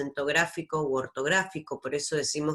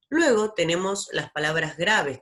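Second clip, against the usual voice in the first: usual speaking voice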